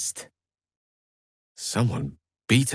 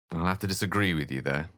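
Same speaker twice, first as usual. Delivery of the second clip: usual speaking voice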